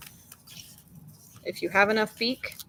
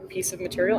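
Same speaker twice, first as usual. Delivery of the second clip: ordinary delivery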